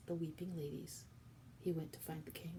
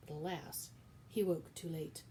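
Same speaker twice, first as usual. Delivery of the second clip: ordinary delivery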